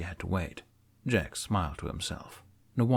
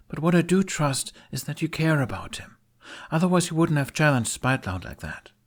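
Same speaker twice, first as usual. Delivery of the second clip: put-on higher, challenging young woman; Astrid; 5 semitones higher than usual. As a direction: chin up, offer help.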